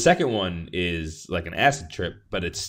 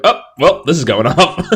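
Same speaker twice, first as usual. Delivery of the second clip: higher, louder mock surprise; 6 semitones higher, 10 dB louder than usual. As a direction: play it up as mock astonishment. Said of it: "So you were like".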